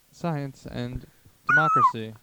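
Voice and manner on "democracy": sudden shrieking high scream; high-pitched scream. Acted. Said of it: "that was my high-pitched scream".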